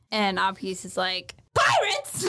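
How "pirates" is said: high squealed alarm with a laugh in it; squealed pirates. Retold or imitated. Frightened kid cries out to the crew, high and laughing. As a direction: shriek it out, half laughing. Apis voiced in retelling.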